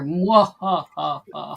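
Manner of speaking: mwahaha villain laugh; evil laugh. Shown as a demonstration. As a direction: cackle like a panto villain. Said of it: "I was practicing her".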